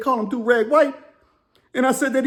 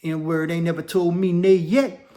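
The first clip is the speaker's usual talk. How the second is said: gangster boast; 4 semitones lower, slower than usual. Retold or imitated. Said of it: "He said".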